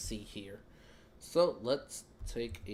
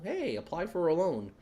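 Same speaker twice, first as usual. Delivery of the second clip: mock sales pitch, raised and louder; sarcastic; 7 semitones higher, 5 dB louder than usual. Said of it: "sorry for the sarcasm".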